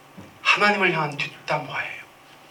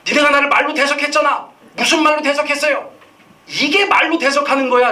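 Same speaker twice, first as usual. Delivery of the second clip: accusing God's voice; 7 semitones higher than usual. Acted.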